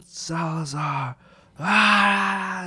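calling a name, then a high, loud cry; retold yell. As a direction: shout the name like a soldier calling you back. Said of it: "he's yelling".

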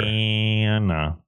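"Banana" slowly, stretching the middle A as in "cat"; pinched-nose American A. Shown as a demonstration. Showing co-host the American A, pinched-nose "Banana". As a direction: drawl "Banana" as buh-NAAAN-uh. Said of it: "Pinch your nose and say it".

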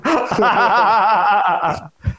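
long, high laugh; real laughter. Real, not acted. Storyteller cracking up at his friends mid-story, long laugh.

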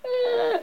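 very high thin scream; acted high-pitched scream. A narrator's reading.

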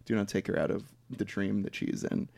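low, soft, solemn refusal; hushed, deep, earnest film-hero voice; Little Bear. Retold or imitated. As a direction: imitate a grave character quietly forbidding it. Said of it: "Little Bear rejects this".

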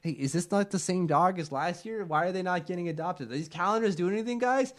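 mock the players' puzzled grumble; mock confused player. Players' complaint acted out.

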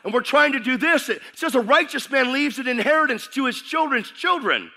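high, loud, driving preacher voice; full-force preaching. Acted. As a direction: preach it hard to the congregation.

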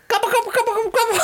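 very high turkey gobbling. Pretend. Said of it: "I was doing a turkey".

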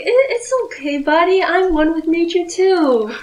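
calm the scared little rat down; soothing the rat. Acted. Player reassures a terrified rat, gently coaxing. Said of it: "I was like".

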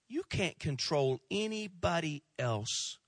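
ordinary talk

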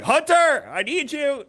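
loud, high urgent call. Retold or imitated. Dad calls his son for help, loud and high. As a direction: shout for help from the other room. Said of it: "I'm like".

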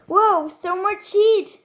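high, excited laughing mock-amazement; Amber imitation. Player copying a fire brawler's line, high imitation. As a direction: imitate a fiery game character, pitched right up. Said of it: "Jetzt Amber".

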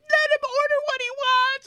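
very high, pleading put-on voice; mock pleading relative. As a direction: beg it in a squeaky voice. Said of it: "and Jan would be like".

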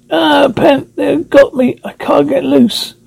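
high, panicked cry of struggle; straining to break free, crying out; struggling against restraints. Acted. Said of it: "held tight in the horrible clutches".

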